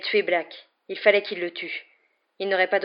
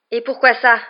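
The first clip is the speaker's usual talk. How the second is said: louder, higher angry retort; sharp, raised demand; heated challenge; 5 semitones higher, 10 dB louder than usual. A narrator's reading. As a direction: throw the question back at him. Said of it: "lança Harry".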